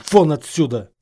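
loud, angry command; barked order. A narrator's reading. Snape ordering Harry out, barking. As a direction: bark it to throw him out. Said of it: "рявкнул Снейп".

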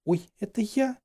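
high, small startled voice; dazed, startled question; taken aback. A narrator's reading. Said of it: "оторопела девушка".

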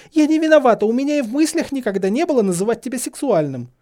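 high, loud, tearful protest; upset, high-pitched self-defence; near-tears outcry. A narrator's reading. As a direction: plead loudly, on the verge of crying. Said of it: "чуть не плача выкрикнула Гермиона".

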